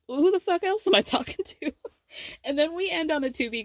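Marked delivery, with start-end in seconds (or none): 0.1-1.7 high, quick mock-incredulous retort; incredulous comeback. Retold daughter answering her mom's "you're asking me?", incredulous. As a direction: imitate an exasperated daughter, pitched up and rushed.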